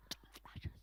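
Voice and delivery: silent mouthing, barely any breath; mouthed whisper. Retold or imitated. Imitating the chemist mouthing the words in a silent whisper. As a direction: shape the words with no voice at all. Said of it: "she mouthed the words".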